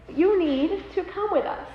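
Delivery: retold paramedic pressing the point; firm insistence. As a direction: spell it out slowly. Paramedic insisting after her refusal.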